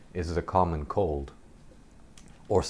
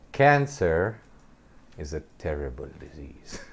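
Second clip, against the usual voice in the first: lecturing; 3 semitones lower than usual. Retold or imitated. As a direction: imitate a grave university lecture. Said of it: "like she's giving a lecture".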